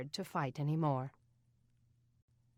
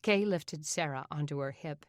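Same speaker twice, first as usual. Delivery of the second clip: plain reading voice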